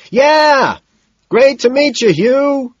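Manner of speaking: very high, loud holler; loud, high-pitched yelling; shouted greeting. A narrator's reading. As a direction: yell hello over blaring music. Shouted greeting in noisy club.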